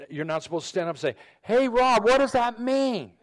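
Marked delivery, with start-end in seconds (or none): 1.4-3.1 high, loud call to the pastor; mock yelling across the room. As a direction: shout it across the room in jest. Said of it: "if a bunch of ladies start yelling across the room".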